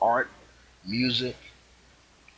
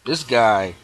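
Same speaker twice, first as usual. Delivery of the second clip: normal talking voice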